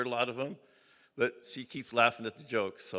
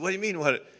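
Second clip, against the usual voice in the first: higher, louder puzzled question; retold disbelief; 6 semitones higher, 5 dB louder, faster than usual. Roper told that he just won, retold disbelief. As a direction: act out being caught off guard.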